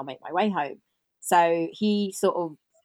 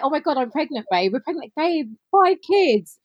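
high, excited reenactment; acted-out astonishment; 9 semitones higher, 5 dB louder than usual. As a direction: blurt it high, getting louder at the end. Acts out astonished couple.

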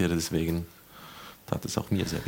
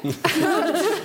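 high burst of laughter; 3 dB louder than usual. Real, not acted. Laughing at story.

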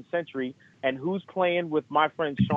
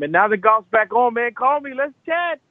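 excited sign-off; 7 semitones higher, 4 dB louder than usual. Real, not acted.

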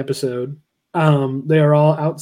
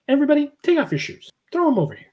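higher, fast bossy instructions; mock command; 11 semitones higher, 3 dB quieter, faster than usual. Eric ordering everyone to hand over shoes, mock command. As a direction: herd the room.

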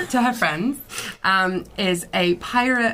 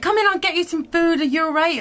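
put-on Scottish accent; 8 semitones higher, faster than usual. Tavern keeper offering the wounded food, Scottish accent. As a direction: offer help in a high Scottish lilt. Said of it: "I'm doing a Scottish accent".

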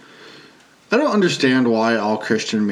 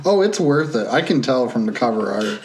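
ordinary talk

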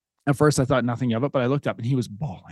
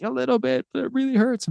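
put-on high, thin kid voice, much higher; imitating hurting son; 12 semitones higher than usual. Dad voicing his son on the bench. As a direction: play the hurt little boy.

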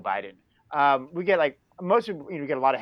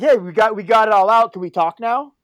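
higher, louder imagined plea to talk; imagined conciliatory appeal; 5 semitones higher, 9 dB louder than usual. Pretend. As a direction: call out an offer of truce. Imagined friendly follow-up to the caller.

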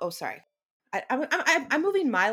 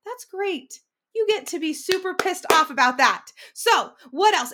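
higher, louder, encouraging cheer; cheering self-encouragement; 11 semitones higher, 9 dB louder than usual. Retold or imitated. Speaker cheering on her angry self, higher and louder. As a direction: pump yourself up warmly. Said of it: "So I'll be like".

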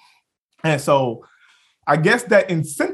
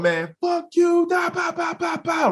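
mock angry woman; 11 semitones higher than usual. Mimicking the angry woman cursing him, high mock ranting. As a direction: ham up her furious babbling.

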